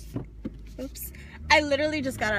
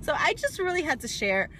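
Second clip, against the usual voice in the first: excited; 7 semitones higher than usual. Real, not acted. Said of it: "full of, like, excitement and emotion".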